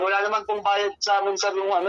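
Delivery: ordinary delivery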